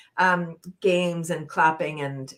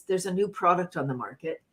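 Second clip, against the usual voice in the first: usual speaking voice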